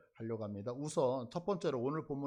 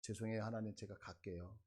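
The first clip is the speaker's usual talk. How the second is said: softly acted meek apology, trailing lower; scolded and apologetic; 4 semitones lower, 6 dB quieter than usual. Preacher voices scolded Moses giving in to God, meek and subdued. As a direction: give in quietly after a telling-off. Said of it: "하나님의 이 야단을 맞고서".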